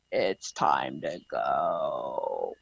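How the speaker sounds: very low, slow, soft demonic growl; sinister voice. Retold or imitated. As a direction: drag it out deep and slow like something evil. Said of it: "all of a sudden I hear".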